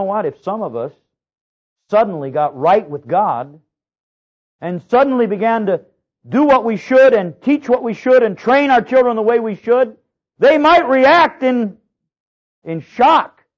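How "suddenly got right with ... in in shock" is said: loud, high climbing sermon shout; preaching at full force. Real, not acted. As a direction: build the appeal to a ringing peak.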